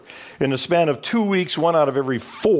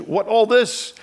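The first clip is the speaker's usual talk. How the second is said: broken English; 7 semitones higher, slower than usual. Retold or imitated. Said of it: "I said in my broken English".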